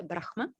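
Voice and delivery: "Brahma" said BRAKH-ma, h before the m; Balinese pronunciation. Shown as a demonstration. Teacher telling what you hear in Bali, "Brahma" as BRAKH-ma. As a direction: say "Brahma" with an audible h, then ma. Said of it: "in Bali, you will hear".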